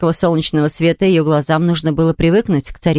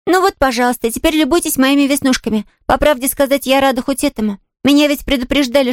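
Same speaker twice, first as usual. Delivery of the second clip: put-on high, artless child's voice; candid little girl; 6 semitones higher than usual. Girl showing the invalid her freckles, candid.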